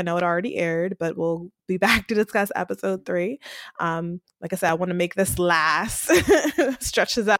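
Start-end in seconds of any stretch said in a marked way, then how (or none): 6.2-6.8 bright burst of laughter; high laugh. Real, not acted. Laughs at own joke.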